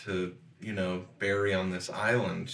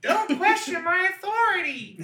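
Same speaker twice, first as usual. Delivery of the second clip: put-on squeaky child voice; 4 dB louder than usual. Kid in trench coat yells.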